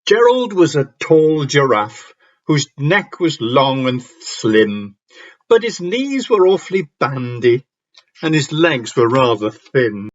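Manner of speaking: slow, measured picture-book narration; story verse reading. Acted. Teacher reading giraffe rhyme to an audience, storytelling. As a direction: read it out like a story to children.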